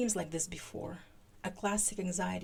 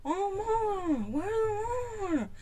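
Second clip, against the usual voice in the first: high, garbled, open-mouthed wail; vowels only, as if the tooth props the mouth open; struggling to speak; 14 semitones higher, 5 dB louder, slower than usual. A narrator's reading. Scully asks for Mulder with mouth jammed open, struggling to form words. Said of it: "struggling to fi-, form words".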